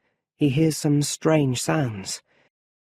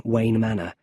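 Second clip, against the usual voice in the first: ordinary delivery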